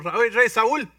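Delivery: high, loud, eager volunteering; bursting to fight the giant; young David pleading loudly with the king; eager boy. Acted. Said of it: "David tells the king".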